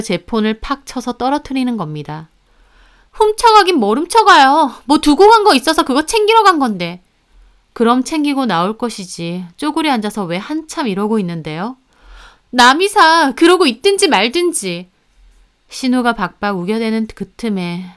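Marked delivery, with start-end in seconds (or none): 3.1-7.0 shrill denial. Acted. Sister denying theft shrilly. 12.5-14.9 defiant snap. Acted. Sister snapping back.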